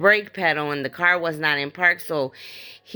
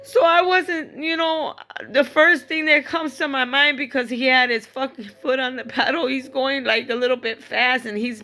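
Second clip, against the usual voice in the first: high crying voice, easing slightly; upset, pitched-up voice thick with crying; tearful; 7 semitones higher than usual. Real, not acted. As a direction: keep explaining through real tears. Still crying as she recounts it.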